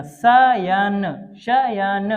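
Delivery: शयन stretched very slowly; slow model reading for pupils; very slow spelled-out word. Shown as a demonstration. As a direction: draw out शयन syllable by syllable. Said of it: "अब आप इसको पढ़िएगा".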